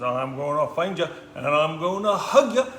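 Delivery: lightly voiced playful mouse; a hint of a teasing boy mouse; little boy mouse. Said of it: "little boy mouse goes".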